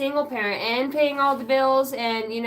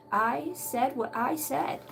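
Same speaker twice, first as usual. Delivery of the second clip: slow, drawn-out, softer restatement; emphatic finality; 3 semitones higher, 5 dB quieter, slower than usual. Acted. Quoting Grandma's saying, slow and final. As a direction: stretch each word to lay down the law.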